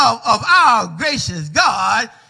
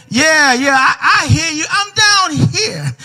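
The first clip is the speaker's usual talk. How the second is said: desperate to be heard from below; a man clinging to a branch calls back frantically; frantic calling up; 6 semitones higher than usual. Acted.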